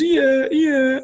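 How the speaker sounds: high-pitched imitation of a little boy; put-on kiddie voice, very high; small child's voice. Dad voices his tearful small son.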